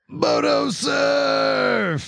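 high, very drawn-out holler; felt catchphrase shout. Shown as a demonstration. Reader redoing the comment after coaching, long high shout. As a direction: stretch it into a long, high surfer war cry. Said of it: "Feel it, man".

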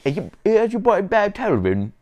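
loud, high fake Cockney; mock Cockney accent. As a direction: bellow a badly faked Cockney show intro.

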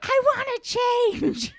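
put-on high whining little girl; whiny bratty kid. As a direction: whine it like a spoiled kid.

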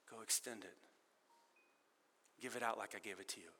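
softly reminding Danny to forgive; gentle Jesus voice. Acted. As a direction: speak low and soft like a patient friend. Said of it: "And then Jesus comes on".

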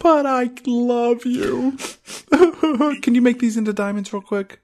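high mock swoon, then a sly ask; mock Mina. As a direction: coo it like a greedy girlfriend.

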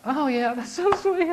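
high, slow, strained and tender; choked-up reply. Retold or imitated. Father answering his little son about grandma, high and slow, fighting tears. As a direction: say it as if holding back tears.

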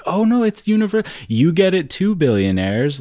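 high mock sweet voice; sassy. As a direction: sing it out sassily to the billionaires. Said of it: "a sassy thing to be like".

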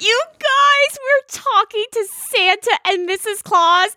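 high-pitched gushing excitement; excited. Real, not acted. Podcast host announcing Santa guests, excited. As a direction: burst out with the big news, pitch way up. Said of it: "I was so freaking excited".

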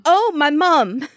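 high, drawn-out mock alarm; imitated flustered exclamation. Imitating a baker about to drop something, mock alarm. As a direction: wail it slowly, as if dropping something. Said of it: "whenever she's about to drop something".

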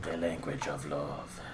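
seductive. Pretend. Mock seductive low voice.

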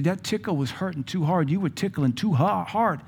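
lightly voicing a hurt five-year-old; child's complaint. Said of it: "He said".

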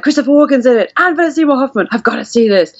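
thrilled about the cast; excited fan. Retold or imitated. Recalling picking the film for its actors, eager and excited. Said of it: "I was like".

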